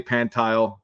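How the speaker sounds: Pantile said as PAN-tile; mispronounced surname. Shown as a demonstration. Guest listing versions of his name, Pantile said PAN-tile. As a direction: make Pantile rhyme with "tile". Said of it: "Nobody actually knows".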